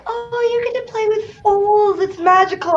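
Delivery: high, dreamy mock gushing; gushing friends. Friends marvelling to her about playing with foals, mock gushing. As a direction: imitate naive friends cooing over baby horses. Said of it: "all my friends would be like".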